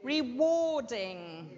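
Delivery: Rewarding said slowly, stress on WAR; drawn-out Rewarding with WAR stressed; reWARding, slow and careful; slow model word. Shown as a demonstration. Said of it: "And the last one?".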